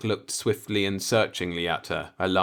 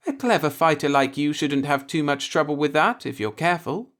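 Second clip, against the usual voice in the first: gaily; 8 semitones higher, 4 dB louder than usual. A narrator's reading. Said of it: "she said gaily".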